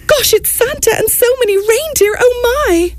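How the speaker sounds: a tiny mouse crying out in wonder; excited cry. Acted. Mouse spots Santa and the reindeer, crying out in high excitement. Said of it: "Mouse let out a cry".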